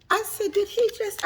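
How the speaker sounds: can't believe what she just heard; shocked. Real, not acted.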